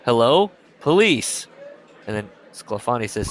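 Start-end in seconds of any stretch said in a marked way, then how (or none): 0.1-1.5 loud, raised calling-out; hollering. Acted. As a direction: holler into a house to see who's home. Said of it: "Nunez hollers in the doorway".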